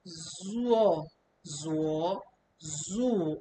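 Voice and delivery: "zuo" tones fumbled, then corrected; a false start on the "zuo" tones; botched tone drill. Shown as a demonstration.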